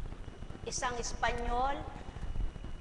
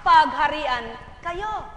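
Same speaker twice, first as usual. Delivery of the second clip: slow, loud benediction; solemn blessing; 10 dB louder, slower than usual. Real, not acted. Preacher blesses the congregation, slow and solemn. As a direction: bless them slowly.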